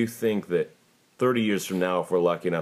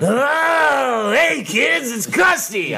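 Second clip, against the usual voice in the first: loud, high yelled clown greeting; shouted high clown voice; Krusty; 5 dB louder, much slower than usual. Pretend. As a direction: yell it like a showbiz cartoon clown. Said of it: "I can do Krusty".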